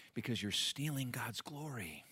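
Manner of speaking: hushed, pointed whisper. Real, not acted. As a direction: lean in and whisper the accusation. Pointed rebuke after pause.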